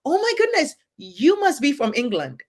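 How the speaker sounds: high-pitched exclamation easing down; delighted recognition. Retold or imitated. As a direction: exclaim it like a happy discovery. Retells greeting a British stranger.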